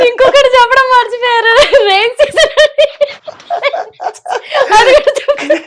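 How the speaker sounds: laughing. Real, not acted. Mocking Sharmila style, laughing.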